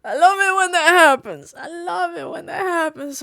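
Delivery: high-pitched sarcastic whine; hammed-up cry that drops from loud to small; mock enthusiasm. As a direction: whine it high, fading on the repeat. Sarcastic outburst after the scare.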